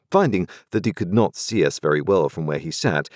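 ordinary delivery, nothing marked

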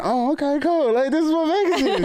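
very high-pitched, delighted squeak of a voice; giddy mock reaction. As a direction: play pleasantly surprised, way up high. His retold reaction to ladies' attention.

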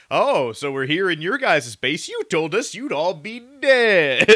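drawn-out sarcastic astonishment, rising to loud; O'Neill. Retold or imitated. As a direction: stretch it into exasperated disbelief. Acts O'Neill's incredulous reaction.

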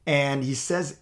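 ordinary talk

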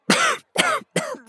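hacking cough after fire; smoky cough. Acted. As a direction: cough up smoke. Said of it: "Bok Bok coughed up smoke".

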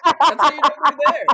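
laughing shout. Real, not acted. Echoing a joke with a shout.

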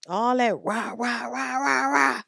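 dismissive imitation of men's rah rah; mocking blustering noise. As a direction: ham up empty macho noise. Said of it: "mocking men's bluster".

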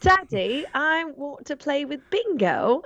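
put-on high little girl voice, Australian; asked for an Australian accent, answers as a little Bluey child; Bluey kid voice. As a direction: play the child begging Daddy. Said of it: "we watch a lot of Bluey in this".

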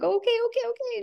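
high strained okays, trying to hold it together; daughter keeping composure. Retold or imitated. Mother on phone, voice high and straining to stay calm. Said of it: "trying to keep her composure".

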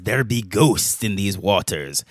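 slow, low, soft pirate villain menace; lightly voiced theatrical pirate captain; Barbossa. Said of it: "reminds me of Pirates of the Caribbean with Captain ... Barbossa".

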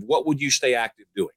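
usual speaking voice

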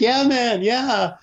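hammed-up awkward friend; awkward politeness. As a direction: humour the stranger awkwardly. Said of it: "And Philip's like".

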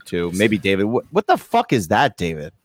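normal talking voice

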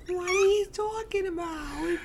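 hammed-up falsetto denial; squeaky protest. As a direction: keep up the squeak to tease. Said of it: "Your voice breaks".

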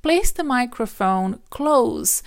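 ordinary delivery

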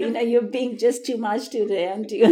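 laughing while scolding dog. Real, not acted. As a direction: talk through laughter.

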